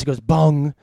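usual speaking voice

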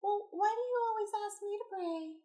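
high voice imitating mom fishing for compliments; high-pitched mimic of mother; mom's voice. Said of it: "she's all like".

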